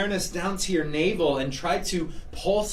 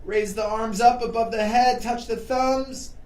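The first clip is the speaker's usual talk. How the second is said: energetic instructor cueing; 5 semitones higher, 4 dB louder than usual. Acted. High-energy yoga cueing over music.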